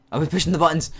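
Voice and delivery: loud, high startled blurt; surprised outburst. Real, not acted. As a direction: blurt it out, caught off guard. Caught out, blurts loudly.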